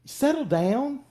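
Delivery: high, loud scolding with a rising end; crowd shushing someone. Acted. Crowd silencing blind Bartimaeus, high-pitched mock scolding. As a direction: imitate a crowd telling him off. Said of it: "what everybody in the crowd was doing".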